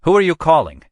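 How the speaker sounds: sharp, loud high demand. A narrator's reading. Captain demands to know who Pendergast calls, loud and high. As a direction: bark the question at him. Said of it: "Custer demanded".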